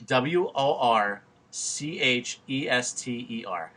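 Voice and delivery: "W O R C H E S T E R" spelt letter by letter, slow; slow deliberate spelling. Shown as a demonstration. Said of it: "How do you say".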